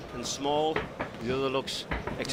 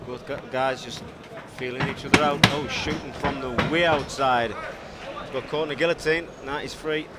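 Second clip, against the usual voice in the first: excited commentary; 4 dB louder than usual. Real, not acted. Commentator reacts to action.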